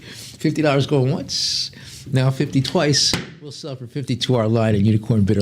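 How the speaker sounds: rhythmic, rising auction chant, pushing for the next bid; lively auction patter; auctioneer bid call. Acted. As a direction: keep the bids rolling like an auctioneer. Auctioneer calling bids.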